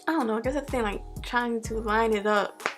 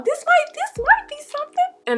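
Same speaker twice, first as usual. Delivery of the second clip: excited; 4 dB louder than usual. Real, not acted. Excited discovery of a wave.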